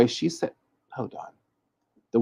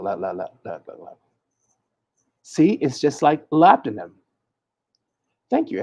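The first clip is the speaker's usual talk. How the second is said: usual speaking voice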